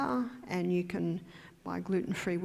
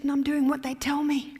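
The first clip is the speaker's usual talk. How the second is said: frustrated patient; 6 semitones higher, 5 dB louder than usual. Retold or imitated. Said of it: "She said".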